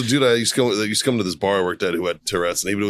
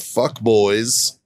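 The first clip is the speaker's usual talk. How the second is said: usual speaking voice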